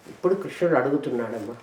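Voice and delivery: ordinary talk, nothing marked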